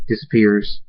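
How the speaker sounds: ordinary delivery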